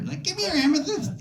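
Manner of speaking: high lisping boxer voice; Mike Tyson impression. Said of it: "Mike Tyson named his daughter".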